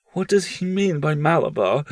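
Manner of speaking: heart-frozen. Acted. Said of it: "asked the heart-frozen mother".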